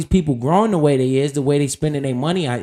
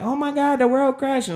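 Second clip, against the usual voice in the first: high-pitched mock panic, much higher; 11 semitones higher than usual. Mimicking scared investors in a high panicked squeal. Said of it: "they like".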